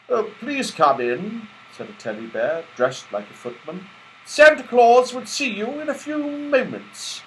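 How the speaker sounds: high, polite footman voice; teddy bear footman. Acted. Footman bear welcoming the toys at the door, high and polite. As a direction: welcome the guests grandly.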